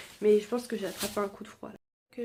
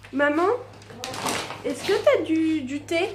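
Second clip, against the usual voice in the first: calling out; 5 semitones higher, 5 dB louder than usual. Real, not acted. Calling mother from another room.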